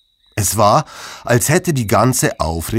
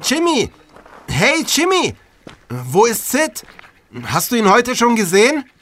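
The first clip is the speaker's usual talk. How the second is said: eager character voice; 12 semitones higher than usual. Acted. Murkas calls to Jimmy.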